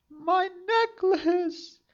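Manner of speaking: very high, slow and timid; high maiden voice. A narrator's reading. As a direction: whisper it like a frightened young girl. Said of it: "replied the maiden".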